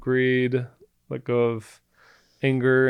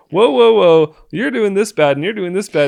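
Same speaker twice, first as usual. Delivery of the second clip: higher, louder defensive protest; defensive pushback; 5 semitones higher, 7 dB louder than usual. Retold or imitated. Husband imitates himself getting defensive, hitting back. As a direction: push back as if attacked. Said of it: "And I'd be like".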